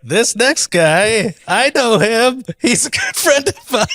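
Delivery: slow, high-pitched mock stage introduction; host's introduction. Comic mimicking the host bringing up his friend, drawn-out announcer. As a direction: draw out each phrase like a hammed-up host. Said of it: "host went on stage and he's like".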